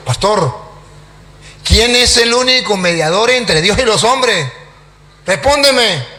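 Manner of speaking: loud, insistent interrogation; demanding an answer. Acted. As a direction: press the silent pastor to answer. Demands answer from silent pastor.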